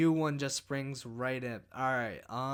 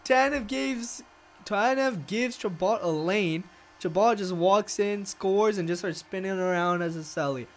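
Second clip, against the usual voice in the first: loud, higher celebratory replay call; real joy, louder and higher; excited celebrating; 4 semitones higher, 6 dB louder than usual. Real, not acted. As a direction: relive the goal, still buzzing. Celebrating the win.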